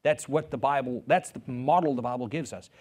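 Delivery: ordinary talk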